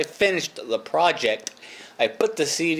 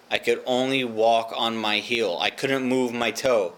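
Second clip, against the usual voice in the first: normal talking voice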